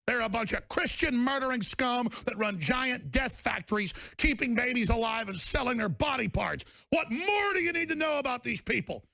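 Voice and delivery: climbing to a high yell; furious shout. Real, not acted. Host yelling to listeners about his enemies. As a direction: build to a roar.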